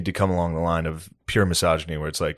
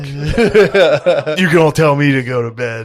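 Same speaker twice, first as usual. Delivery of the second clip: loud, slow and higher; mock indignation; 5 semitones higher, 6 dB louder, much slower than usual. Boyfriend defying bedtime orders, mock indignant shout. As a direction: drag it out as a defiant challenge. Acted defiant reply to partner.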